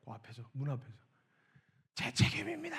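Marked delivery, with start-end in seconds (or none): 1.9-2.8 high, anguished cry of the confession; retelling the pastor's anguished wail. Retold or imitated. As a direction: wail it in guilt.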